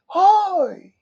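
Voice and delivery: high yell thrown back as the echo; echo shout. Acted. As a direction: play the echo, copying the shout. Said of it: "umeko dun sa pangatlong bundok ng".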